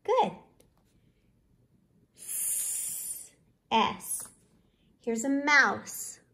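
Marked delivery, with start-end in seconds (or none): 2.2-4.2 "S" as a long unvoiced hiss, no vowel; hissed s sound. Shown as a demonstration. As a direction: hiss out a soft sss for the ending sound. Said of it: "Tell me".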